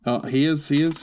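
ordinary delivery

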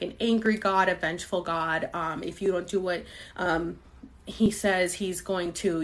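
ordinary talk, nothing marked

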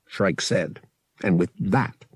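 usual speaking voice

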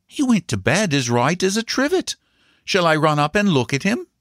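high-pitched governess voice; reassuring governess. A narrator's reading. Governess answers the mother.

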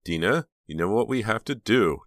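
plain reading voice

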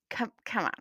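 breathy, worn-out come on; exasperated sigh. Real, not acted. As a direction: sigh it out, fed up with silly flavors. Exasperated at Oreo flavors.

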